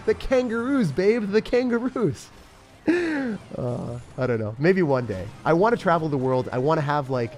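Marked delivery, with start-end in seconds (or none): 0.0-2.3 high, slow mock plea; pleading himself. Answering his girlfriend's fear, high pleading mock voice. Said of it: "And I'm like". 2.9-3.5 laugh. Real, not acted. Laughs at own bit.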